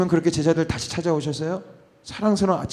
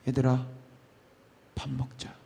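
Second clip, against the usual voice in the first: 낮고 부드럽게 부르는 목소리; 조용히 따뜻하게 불러 주듯; 다정한 예수님; 6 semitones lower, 11 dB quieter than usual. Acted. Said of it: "딱 한마디 하세요". (부드럽고 다정한 부름)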